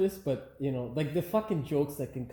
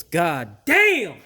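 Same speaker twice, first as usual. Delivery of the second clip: frustrated curse; 8 dB louder than usual. Real, not acted. Bolt mishap.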